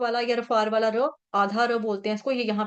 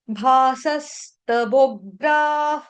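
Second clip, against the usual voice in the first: भासस्तवोग्रा drawn out very slowly; slow demo; 4 dB louder, much slower than usual. Shown as a demonstration. Teacher drilling the verse word, very slowly. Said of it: "आपने आघात लेना है".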